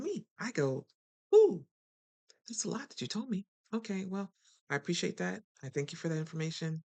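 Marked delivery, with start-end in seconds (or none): 1.3-1.6 very high, loud surprised ooh; a startled squeal of ooh; over-the-top shocked ooh; mock shocked ooh. Reacting to too much info. 2.5-6.8 higher, sugary polite brush-off; prim fake-gracious goodbye; overly polite exit. Politely exiting an argument.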